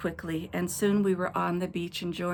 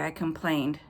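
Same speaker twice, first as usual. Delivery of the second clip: ordinary delivery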